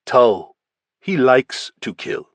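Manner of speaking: a hint of a Russian accent, slow and deliberate; lightly accented Russian gangster, spelling it out; Russian gangster naming the killer, slow; slow Russian accusation. Volkov accuses Tull.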